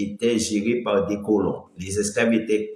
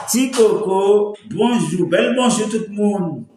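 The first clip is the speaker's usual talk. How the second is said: sing-song greeting; 9 semitones higher, 5 dB louder than usual. Real, not acted. Livestream greeting to viewers.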